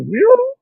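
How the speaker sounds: high-pitched swooping "whew"; imitated jet stream whoosh. Shown as a demonstration. Showing a listener how jet streams shoot up, high whooshing noise. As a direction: sound out a streak shooting sharply upward. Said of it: "jet stream things that are like".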